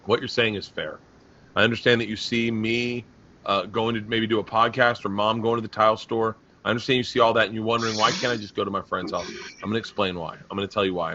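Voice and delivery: low, soft, measured reasoning; very calm. Retold or imitated. Father calmly explaining lockdown rules to his daughter, very calm. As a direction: retell it as a father patiently keeping his voice down. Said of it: "very calmly, I said".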